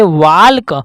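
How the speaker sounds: "वाल्क" with the L pronounced before K; walk with L sounded. Shown as a demonstration. Teacher reading the word as written, L sounded in "वाल्क". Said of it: "एल जो है इसमें क्या हो जाता है".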